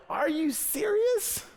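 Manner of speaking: high, slow, stretched-out disbelief. Retold or imitated. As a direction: drag out serious in shock. Retold outrage at tiny paycheck.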